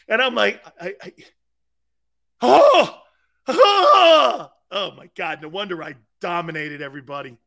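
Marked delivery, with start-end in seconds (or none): 2.4-4.5 high, loud wordless cry of mock shock; hammed-up "oh" wail; loud drawn-out oh. His loud mock reaction to accusers.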